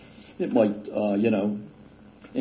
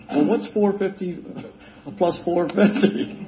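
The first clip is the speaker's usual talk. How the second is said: a mock teasing shopkeeper, higher and drawn out; mocking seller; 7 semitones higher than usual. Seller teasing a customer over simple sums, mock condescending. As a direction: play the clerk talking down slowly.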